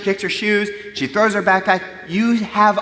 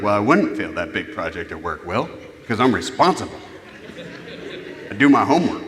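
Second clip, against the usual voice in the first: lower, put-on smug reply; hammed-up responsible grown-up; mock self-righteous voice; 8 semitones lower than usual. Parent objecting to imagining failure at work, mock self-righteous. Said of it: "I don't know why I did that voice".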